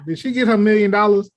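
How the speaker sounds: normal talking voice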